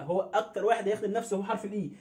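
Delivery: usual speaking voice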